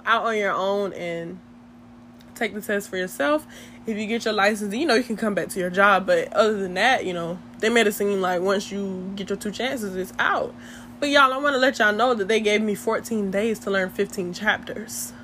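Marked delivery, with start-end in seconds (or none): none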